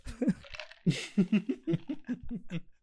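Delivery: real laugh. Real, not acted. Laughing at the plot twist.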